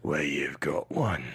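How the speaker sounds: very low, whispery gravel; Jason Statham. Retold or imitated. Host imitates the movie's monster quote, gravelly low voice. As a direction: drop the voice right down, slow and menacing. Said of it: "he drops his already low voice".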